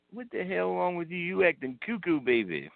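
high mock Dusty voice; bemused Dusty, baby at the end; Dusty puzzled. Dusty to the kneeling wrestler, mock puzzled. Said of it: "Dusty looks down at him and says".

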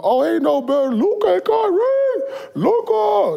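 high, slow mocking imitation of his friend; mocking cohost's voice. Imitating cohost to tease him, high and slow. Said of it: "That's just how you sounded".

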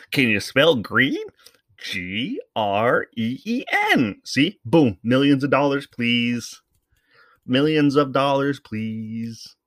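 put-on high, sing-song kids' host voice; mocking Blippi voice. Podcaster mocking a kids' YouTuber, sing-song. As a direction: mimic a goofy children's presenter. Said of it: "you watch Blippi and you think".

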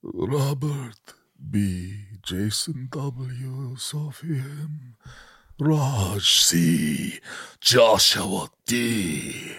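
slow eerie drawn-out names; spooky voice. Acted. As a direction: ham up a spooky voice. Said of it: "do 'em in a spooky voice".

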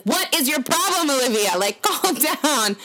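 mom's sharp high scolding, then a slowed calm down; exasperated mom. Retold or imitated. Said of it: "My mom comes down and she's like".

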